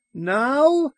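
put-on very high, questioning boy's voice; young boy. A narrator's reading. Son calling back from upstairs, high boyish question. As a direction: pipe up high like a surprised child.

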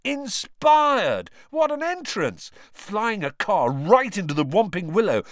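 loud, high, racing cheer; excited shouting. A narrator's reading. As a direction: rave about it at top speed. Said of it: "yelled Lee Jordan".

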